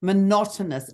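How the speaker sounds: ordinary talk, nothing marked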